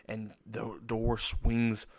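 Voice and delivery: usual speaking voice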